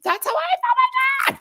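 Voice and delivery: excited squeal. Real, not acted. Exclaimed agreement.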